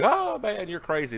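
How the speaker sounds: put-on objection from the audience; mock viewer protest. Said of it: "Y'all probably say".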